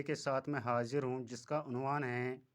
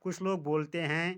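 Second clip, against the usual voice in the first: ordinary talk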